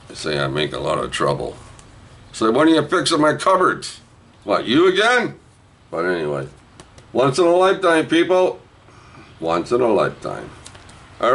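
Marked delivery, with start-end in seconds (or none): 2.3-5.4 high-pitched pestering question; high mock complaint; acted complaint. Acts out exchange with building people. 7.1-8.6 high, called-out sales slogan; high barker-like pitch; hawking to the crowd. Real, not acted.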